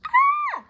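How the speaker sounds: very high, loud playful scream; mock scream. Reader reacts to "Spooks and Screams", high mock scream. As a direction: squeal as if spooked.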